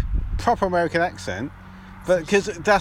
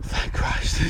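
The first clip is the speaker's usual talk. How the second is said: retold whisper of relief; relieved whisper. Caller relieved that the chat was muffled, relieved whisper. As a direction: breathe it out under your breath.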